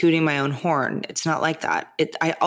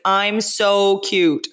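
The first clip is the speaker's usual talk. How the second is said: dog's voice; 5 semitones higher, 5 dB louder, much slower than usual. Pretend. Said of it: "He's like".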